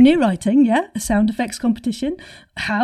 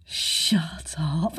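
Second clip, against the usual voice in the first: breathy amazed whisper; whispered shut up; 6 semitones lower, 5 dB quieter than usual. Real, not acted. Host reacting to secret bar room, awed whisper. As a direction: hush it out in disbelief.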